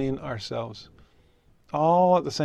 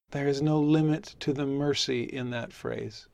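ordinary delivery

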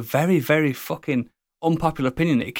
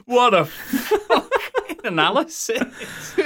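laughing; 10 semitones higher, much slower than usual. Real, not acted. Laughing at friend's over-the-top review.

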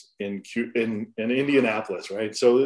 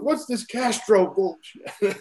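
slow, high mock-angry demand; retold angry yell; 7 semitones higher, slower than usual. Coach confronting the young pitcher face to face, slow mock-angry yell. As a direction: drag each word out like an angry coach. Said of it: "he just started screaming at me".

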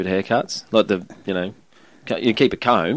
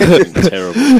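laughing; 11 semitones higher, 10 dB louder, slower than usual. Real, not acted. Laughing at bad accent attempt.

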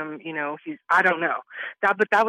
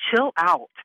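"Chill out" retold louder, with a curt edge; mock aggressive; 7 dB louder than usual. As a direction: imitate his pushy, dismissive tone. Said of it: "because he was so aggressive".

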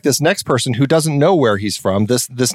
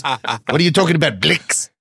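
bad mock Australian accent; Quentin Tarantino. Podcaster mocking a director's cameo, bad Australian accent. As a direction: attempt a clumsy Australian accent on one line. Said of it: "he's supposed to be Australian, but he's not".

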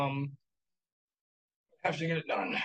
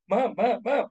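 put-on whiny kid; 6 semitones higher, 5 dB louder than usual. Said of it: "You know?".

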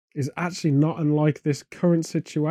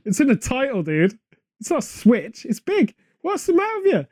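high-pitched incredulous protest; mock exasperation; 7 semitones higher than usual. Real, not acted. Correcting co-host about the film Big, exasperated. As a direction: argue it indignantly, pitch climbing sharply.

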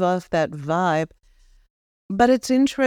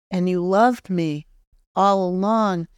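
usual speaking voice

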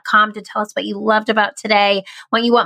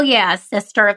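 normal talking voice

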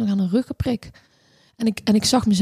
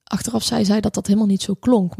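ordinary talk

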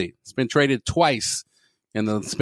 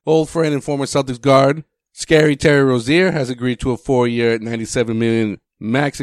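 mock announcer; 5 dB louder than usual. Mock announcer intro of news.

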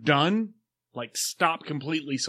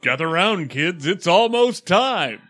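slow, louder creepy ad voice; Silver Shamrock announcer; 5 semitones higher, 6 dB louder, slower than usual. Shown as a demonstration. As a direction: lure the kids in slowly. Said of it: "as the Silver Shamrock guy".

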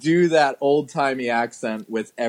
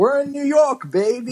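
much higher, hammy showbiz cheer; cheesy showbiz voice; 11 semitones higher than usual. Retold or imitated. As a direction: sell it like a cheesy Broadway guy. Mocking showbiz movie line.

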